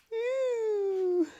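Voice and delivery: high squealing ew; disgusted ew. Real, not acted. Disgusted by slime.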